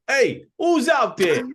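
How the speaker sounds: high, loud suspicious call through the door; wary householder shouting who's there. Acted. GM voicing a wary NPC calling through the door, loud and high.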